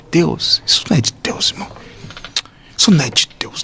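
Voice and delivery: quiet, half-voiced aside to God; hushed retold misgiving; muttered doubt. Retelling his muttered doubt to God.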